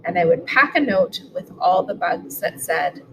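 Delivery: plain reading voice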